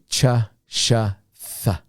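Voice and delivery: "Ch, sh, th" as three separate short sounds; teacher sounding out "Ch, sh, th" one by one; isolated letter-blend sounds. Shown as a demonstration. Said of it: "one sound. C H, S H, T H".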